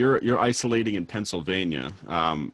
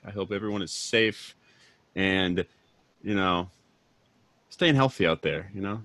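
bright, booming radio host; 4 semitones higher, 3 dB louder than usual. Acted. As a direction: announce it like a DJ. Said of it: "this is when the radio voice".

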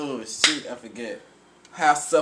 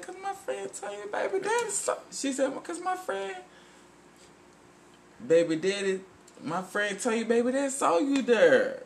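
woman's voice quote; 11 semitones higher than usual. Retold or imitated. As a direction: say it high and breathy like a gossiping woman.

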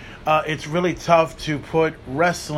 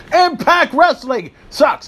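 mocking rant; 9 semitones higher, 4 dB louder, slower than usual. Real, not acted. Ranting list of promotions.

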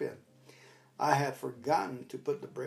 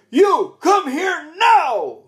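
much higher, louder, slow bellowed command; booming barroom shout; bar voice; 13 semitones higher, 13 dB louder, much slower than usual. Retold or imitated. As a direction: roar it across the lot to freeze him. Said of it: "with my bar voice".

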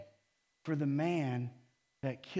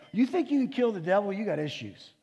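mocking disbelief; 5 semitones higher, 5 dB louder than usual. Real, not acted. Mocking the tough guys.

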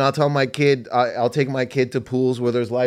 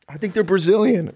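high, earnest mock report; excited about fish; 7 semitones higher, 3 dB louder than usual. Snorkeler guessing where fish come from, excited. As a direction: offer it as a thrilling fact, pitched up. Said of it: "grown-ass men get so excited about fish".

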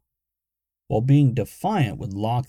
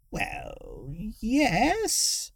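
put-on high old woman, slow and grudging; said slowly; 11 semitones higher, 3 dB quieter than usual. A narrator's reading. Old woman grudgingly admitting, slow. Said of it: "Tiggy said slowly".

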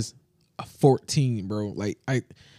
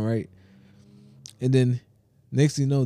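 ordinary talk

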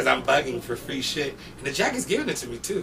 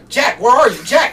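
calling out; 9 semitones higher, 11 dB louder than usual. Retold or imitated. Manager calling Jack.

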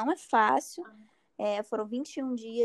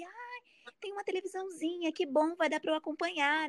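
retold naive delight; 4 semitones higher than usual. Patient telling herself the exam will be easy, naive delight.

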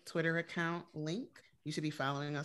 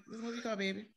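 lightly sweet New Orleans lady request, softer; New Orleans sweet talk; 6 semitones higher, 5 dB quieter, faster than usual. Retold or imitated. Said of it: "That's all I said".

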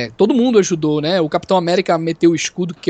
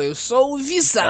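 mock Vision line; 6 semitones higher than usual. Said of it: "ainda bem que ele não falou".

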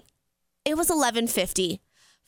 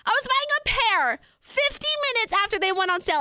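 high-pitched, emphatic disbelief; incredulous squeal; amazed; 10 semitones higher than usual. Real, not acted. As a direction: pitch way up, marvelling at the luck. Emphatic amazement at her luck.

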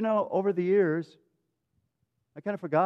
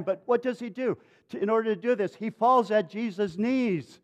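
ordinary talk, nothing marked

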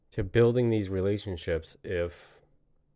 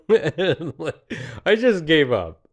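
laughing; 6 semitones higher, 6 dB louder, slower than usual. Real, not acted. Laughing while retelling defeat.

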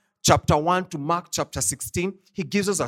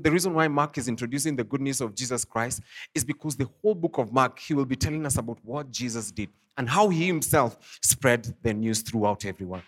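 normal talking voice

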